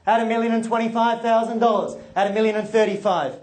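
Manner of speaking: rhythmic auction bid calling; auctioneer call. Real, not acted.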